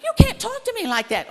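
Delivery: loud, high-pitched outraged parent; indignant scolding. Retold or imitated. Mother arguing with her five-year-old daughter, indignant and loud. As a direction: snap it back as an affronted mother. Mother retorting to defiant child.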